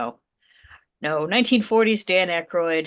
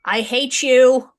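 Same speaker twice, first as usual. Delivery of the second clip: slow, louder, playful exaggerated grudge; mock hate; 6 semitones higher, 7 dB louder, slower than usual. Real, not acted. Player playfully tells the GM they hate him. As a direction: drag it out in mock disgust.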